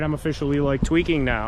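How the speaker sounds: usual speaking voice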